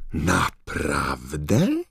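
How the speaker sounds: slow, drawn-out amazed question. Acted. Mr Weasley marvels at the electric fire, slow amazed question. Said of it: "zdumiał się pan Weasley".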